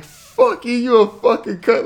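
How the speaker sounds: high, loud imitation of a raging friend; livid. Friend calling the prankster every name, livid. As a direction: play the livid friend hurling insults. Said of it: "he was livid".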